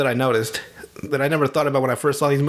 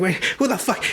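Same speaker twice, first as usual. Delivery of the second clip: mock flustered fumbling; 6 semitones higher than usual. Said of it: "he just starts fumbling".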